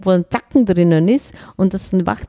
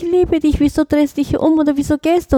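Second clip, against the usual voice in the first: high, pleading plea; begging; 8 semitones higher than usual. Shown as a demonstration. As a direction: beg them not to leave, voice raised high. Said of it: "oder betteln dich". (flehend und bettelnd, deutlich höher)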